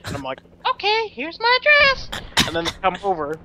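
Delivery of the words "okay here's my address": put-on very high, girly reply; put-on girl voice. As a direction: answer high as the decoy girl. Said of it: "be the voice of the chick".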